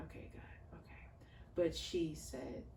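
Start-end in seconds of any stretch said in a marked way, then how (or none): 0.1-1.0 soft breathy whisper to God; a whispered plea for patience; whispered aside. Real, not acted. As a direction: mutter it to heaven under your breath. Aside prayer under breath.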